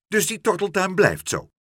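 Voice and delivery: high, loud cross question; angry calling out. A narrator's reading. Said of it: "riep mevrouw Helderder nijdig".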